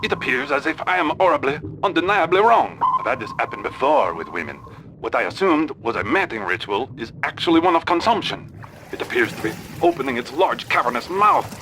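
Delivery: put-on fast, rising French-accented panic; rising alarm. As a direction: rattle it off as alarm builds. Explorer realizes he'll be swallowed.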